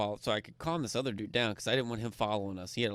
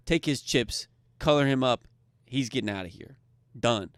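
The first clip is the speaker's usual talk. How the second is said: curt loud order; 8 dB louder than usual. Retold or imitated. Ordering dealer to cash out.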